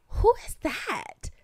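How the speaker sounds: lightly voiced gossipy friend; curious friend. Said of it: "I remember Jane being like".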